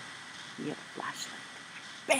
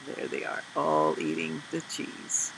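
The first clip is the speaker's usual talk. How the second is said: hushed whisper; 13 semitones lower, 6 dB quieter than usual. Real, not acted. Pointing at picture, hushed.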